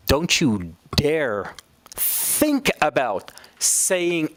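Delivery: slow, drawn-out stern warning; emphatic warning. Retold or imitated. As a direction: stretch out DARE and THINK with heavy emphasis. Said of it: "to add emphasis to the verb".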